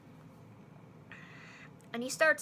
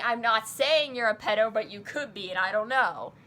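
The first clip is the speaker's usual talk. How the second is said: retold vent; 3 semitones higher, 7 dB louder than usual. Continuing the retold angry vent.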